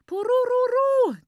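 high, sustained, loud celebratory trill; booming ululation. A narrator's reading. Lucia cheers with hand at mouth, booming ululation. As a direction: let a long joyful ululation ring out. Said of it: "dröhnte sie, die Hand am Mund".

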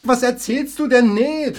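high, loud, slow demand; loud challenge. Acted. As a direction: call him out. Confronting Mike.

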